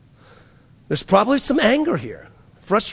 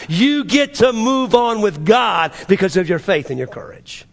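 high, driving sermon delivery; preaching at full force; 5 semitones higher than usual. Acted. Pastor urging the church, preaching at full force. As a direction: lift the church with each promise. Preacher listing benefits, raised.

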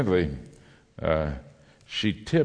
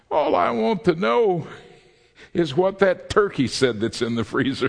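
high squawky parrot voice, much higher at first; 10 semitones higher than usual. Acted. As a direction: play the cheeky parrot in the punchline. Punchline spoken by the parrot.

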